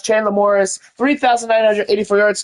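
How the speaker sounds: ordinary talk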